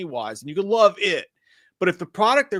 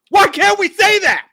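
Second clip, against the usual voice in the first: much higher, much louder yelled question; frustrated shout; 11 dB louder than usual. Real, not acted. Podcast host yells his frustration at the co-host. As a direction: blow up at the unfairness of it. Frustrated rant about criticising Muschietti.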